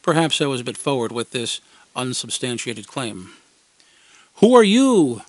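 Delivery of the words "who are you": put-on high, loud kid challenge; kids demanding. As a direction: bark it like a suspicious kid. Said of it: "they demanded".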